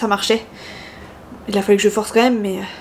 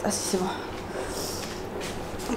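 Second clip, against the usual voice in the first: whispered under breath; whispered aside; 2 semitones lower, 14 dB quieter, much slower than usual. Real, not acted. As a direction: breathe it to yourself. Muttering to herself. (chuchoté pour soi)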